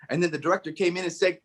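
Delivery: ordinary talk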